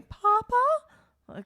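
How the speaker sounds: put-on very high, plaintive child's call; Nelson. Podcaster imitating the cartoon's running dad joke, high mock call. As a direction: imitate a boy calling out for his father. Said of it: "Oh, Nelson going".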